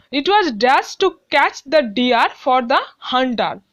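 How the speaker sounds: reads the sentence very slowly, word by word; slow classroom read-aloud; slow dictation reading. Shown as a demonstration. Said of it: "teacher reads exercise sentence aloud".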